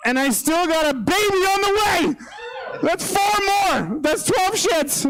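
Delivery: high, drawn-out incredulous yell; exasperated shouting. Acted. Overwhelmed dad counting diapers for the crowd, exasperated shout. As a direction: shout the tally at the end of your rope.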